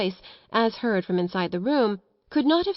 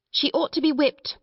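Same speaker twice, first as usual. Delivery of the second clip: higher, indignant headmistress; stern proclamation; 7 semitones higher than usual. A narrator's reading. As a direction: pronounce judgment on a naughty child. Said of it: "Miss Minchin proclaimed".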